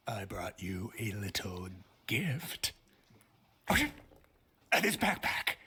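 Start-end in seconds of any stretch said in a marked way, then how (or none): 0.1-2.7 low slow menace. Acted. Villain offers gift slowly, low.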